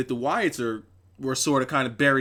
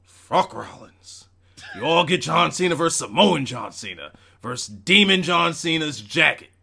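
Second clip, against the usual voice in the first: hammy wrestling boss pronouncement, punching the big names; Vince McMahon; 4 dB louder than usual. Acted. Reading a viewer's donation to the stream, mock monotone boss voice. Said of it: "in the Vince McMahon monotone voice".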